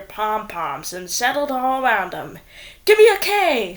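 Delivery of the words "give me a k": high, loud cheer; cheerleader shout. A narrator's reading. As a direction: yell it out to the crowd. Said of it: "shouted the cheerleaders".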